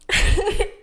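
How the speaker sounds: unvoiced, high embarrassed laugh burst; breathy laugh. Real, not acted. Vlogger laughing at her own grief over a camera, breathy laugh.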